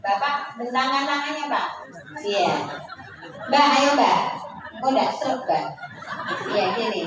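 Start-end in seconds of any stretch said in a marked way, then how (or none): none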